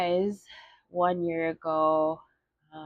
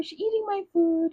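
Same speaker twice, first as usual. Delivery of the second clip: put-on much higher whiny child's tattle; tattling little kid; 13 semitones higher than usual. Little sister tattling to mom on older sister, whiny high kid voice. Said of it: "And so I'd be like".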